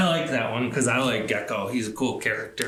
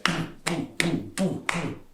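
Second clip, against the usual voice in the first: stomping booms; 3 semitones higher, 4 dB quieter, slower than usual. A narrator's reading. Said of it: "stomped up and down".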